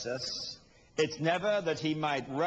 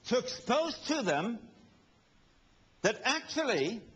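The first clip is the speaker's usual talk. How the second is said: slowed emphasis; 8 semitones higher, slower than usual. Acted. Slow emphatic sermon climax.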